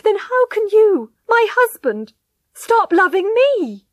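high, loud, impassioned appeal, stretching key phrases; desperate pleading. A narrator's reading. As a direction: plead desperately for his love. Wife begs husband in anguish.

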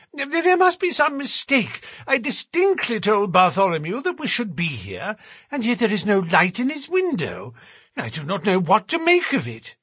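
high-pitched, stammering bafflement; uneasy gentleman. Acted. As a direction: fret in a high, troubled voice. Voices uneasy Thaddeus Sholto.

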